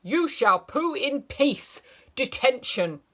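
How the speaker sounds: furious teacher shouting at the toilet door. Acted. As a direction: bellow through the door. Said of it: "Before shouting".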